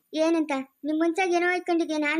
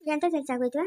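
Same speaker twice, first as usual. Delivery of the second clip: usual speaking voice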